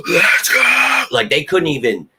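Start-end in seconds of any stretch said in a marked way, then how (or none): 0.1-1.0 high, loud fan cheer; mock stadium yell. Fan drowns out stadium booing, high yell. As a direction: yell it over the booing crowd. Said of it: "I'd be like".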